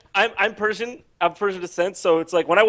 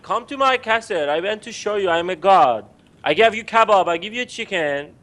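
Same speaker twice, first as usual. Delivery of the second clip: put-on Persian accent. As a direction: offer it grandly in a Persian accent. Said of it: "if it were accurate".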